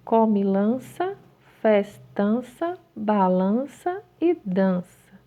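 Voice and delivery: each word slow and drawn out for dictation. Shown as a demonstration. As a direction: dictate slowly, syllable by syllable. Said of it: "a mamãe pode ditá-las". (ditado lento e pausado)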